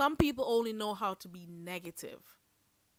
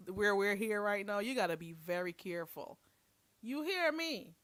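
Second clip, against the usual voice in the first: usual speaking voice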